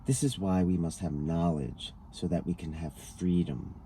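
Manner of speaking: ordinary delivery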